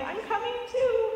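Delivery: high-pitched imitation of a one-year-old; playful little-kid voice; toddler voice. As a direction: pipe up like a baby sister tagging along. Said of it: "there's one-year-old Junia, like".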